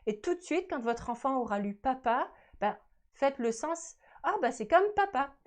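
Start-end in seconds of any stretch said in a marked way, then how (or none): 4.2-5.3 delighted realization. Acted. Parent voices sudden link to meaning.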